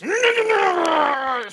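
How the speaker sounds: high, loud manic shriek right in his face; wild scream. Acted. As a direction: shriek to scare him. Villager grabs and shakes player.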